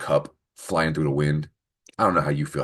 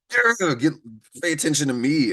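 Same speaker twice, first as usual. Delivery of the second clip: much higher playful call for attention; mock attention grab; 9 semitones higher than usual. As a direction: squeal at them to pay attention. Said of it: "I can really be like".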